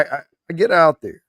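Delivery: ordinary delivery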